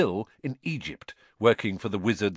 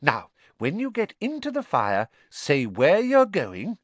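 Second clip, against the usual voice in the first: Mrs Weasley, instructing; 8 semitones higher, 5 dB louder than usual. A narrator's reading.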